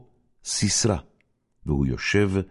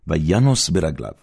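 ordinary delivery, nothing marked

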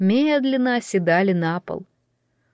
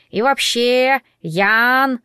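high, raised parrot squawk; mimicking bird, pitched up; parrot declaring; 4 semitones higher, 4 dB louder, much slower than usual. A narrator's reading. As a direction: parrot it back insistently, stretched out. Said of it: "заявил он".

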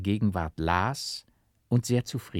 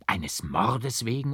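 ordinary delivery, nothing marked

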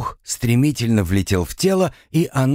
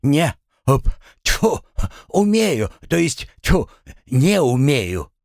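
high, broken spluttering between words; 8 semitones higher, slower than usual. A narrator's reading. Drowning man answers whether he can swim, spluttering. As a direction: spit out water while trying to answer. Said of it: "Его вырвало, и изо рта полилась вода".

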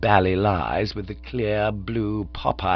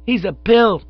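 put-on high, loud kid insult; definite child; 13 semitones higher, 7 dB louder than usual. A narrator's reading. Child insulting Cyril to pop, high and definite. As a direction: jeer as a small child. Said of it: "He became more definite".

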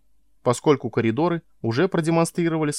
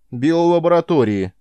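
loud retort; 6 semitones higher, 6 dB louder than usual. A narrator's reading. Guard answers the intruder.

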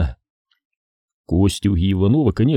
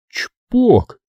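popping sound; 13 semitones higher, 4 dB louder than usual. A narrator's reading. Звук выстрела.

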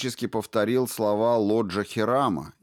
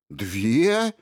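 surprised question with a steep rise; natural surprise; 3 semitones higher, 4 dB louder than usual. A narrator's reading. Suspect hears of two media spheres, surprised. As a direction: echo it, genuinely taken aback. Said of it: "Так натурально удивился он".

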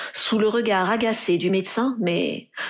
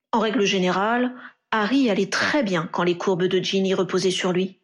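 ordinary delivery, nothing marked

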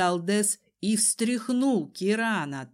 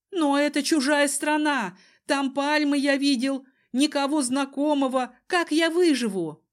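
put-on high, plaintive young man's voice; whining, frightened Kiran; 6 semitones higher, 4 dB louder than usual. A narrator's reading. Cousin fears surviving in a foreign country, whining. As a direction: protest in a raised, anxious whine.

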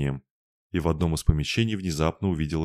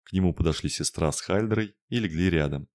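ordinary delivery, nothing marked

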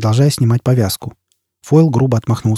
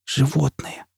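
softer, hushed insult; whisper; 3 semitones higher, 8 dB quieter, slower than usual. Acted. Jiz whispers to Foyle in his embrace. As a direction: breathe it, melting. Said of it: "прошептала Джиз".

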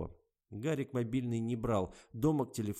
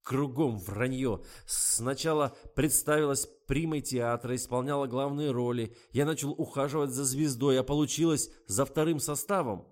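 loud, higher accusing rant; angry tirade; 5 semitones higher, 7 dB louder than usual. A narrator's reading. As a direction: hurl the accusations at her. Garik scolds his lying lover.

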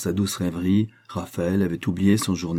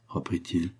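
ordinary delivery, nothing marked

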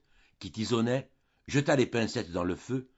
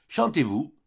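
bright-eyed, higher-pitched exclamation; exclaiming; 5 semitones higher, 4 dB louder than usual. A narrator's reading. Countess heading to her piano, exclaiming. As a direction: burst out with the question, pitched up. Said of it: "s'écria-t-elle".

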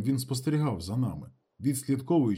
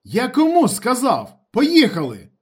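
high, very loud furious order; commanding angry shout; 11 semitones higher, 11 dB louder, slower than usual. A narrator's reading. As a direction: yell so they have to obey. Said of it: "В голосі його з'явилось щось таке".

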